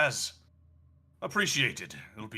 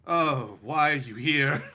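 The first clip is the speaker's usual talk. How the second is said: sudden startled question; realizing surprise; 3 semitones higher, 5 dB louder than usual. Acted. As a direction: catch on mid-sentence. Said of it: "starts to realize what he's done".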